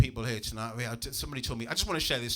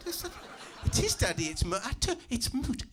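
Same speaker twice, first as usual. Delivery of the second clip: put-on high, laughing little girl; cheeky child, amused at dad; 8 semitones higher than usual. Daughter repeats, laughing.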